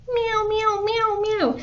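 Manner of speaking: very high-pitched imitated meows; squeaky cat impression; cat meowing. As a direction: meow over and over like a cat. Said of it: "And the cat went".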